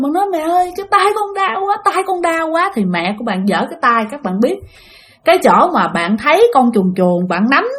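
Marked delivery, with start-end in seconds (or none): 0.3-2.7 put-on high, plaintive child's complaint; imitated whimper, pitched up without loudness; crying in pain. Injured child telling mother about hand, crying in pain. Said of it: "bạn khóc ré lên".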